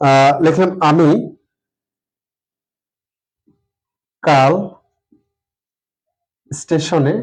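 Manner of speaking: word by word, long pauses while writing; slow dictation. Shown as a demonstration. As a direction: spell it out slowly as you write the sentence. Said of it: "একটি বাক্য সাজিয়ে লিখি". (লেখার সময় ধীরে ধীরে বলা)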